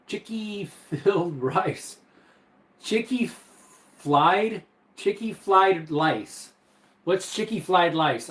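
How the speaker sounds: "chicky flied lice" read slowly, with r swapped for l, half-laughing; reading a chat typo aloud. Retold or imitated. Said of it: "Instead of chicken fried rice is what he meant".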